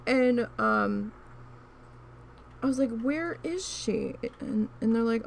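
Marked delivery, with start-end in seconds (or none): 3.0-4.1 high, slow, drawn-out demand; exasperated question. Retold or imitated. Asking coworkers where the late cashier is, high and exasperated. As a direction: stretch it out, fed up and waiting.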